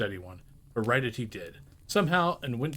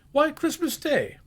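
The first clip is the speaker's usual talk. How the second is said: put-on much higher, slow boyish wonder; astonished boy; 4 dB louder, slower than usual. The boy telling Scrooge the day, amazed. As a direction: answer as a boy who can't believe it.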